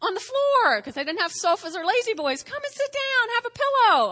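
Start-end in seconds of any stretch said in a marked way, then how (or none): 0.0-0.8 high, bright hostess voice calling guests in; Martha welcoming guests. Acted. 2.4-4.1 high, bright hostess voice calling guests in; Martha welcoming guests. Acted.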